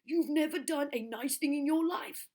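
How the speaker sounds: high, loud, slow indignant; Trunky elephant. Acted. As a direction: sneer at the plans. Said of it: "said Trunky".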